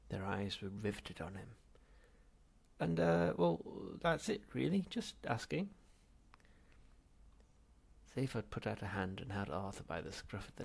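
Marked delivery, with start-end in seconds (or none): 2.8-5.7 sheepish. Acted. Arthur sheepishly backing off.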